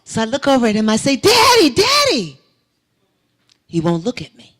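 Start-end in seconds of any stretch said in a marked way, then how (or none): 1.2-2.4 high, loud call for Daddy. Retold or imitated. Daughter calling her unseen father in a dream, loud calling out. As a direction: call out to him across the field.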